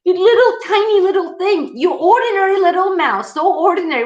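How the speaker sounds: high, mocking voice of a boastful lion; scornful lion. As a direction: sneer down at the little mouse from way up high. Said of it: "And the lion is like".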